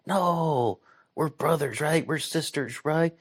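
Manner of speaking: high, pleading voice to the locals; mock pleading. Vlogger imitating her plea to West Virginians, high and pleading. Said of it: "And I'm like".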